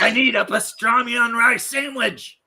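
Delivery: high, put-on Yiddish Klingon voice; Yiddish accent. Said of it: "thick Yiddish accent".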